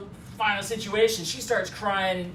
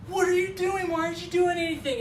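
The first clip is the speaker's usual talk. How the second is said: high-pitched panicked demand; hammed-up, high and frantic; mock freak-out; 9 semitones higher, faster than usual. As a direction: imitate a woman freaking out at her driver. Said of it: "freaking out".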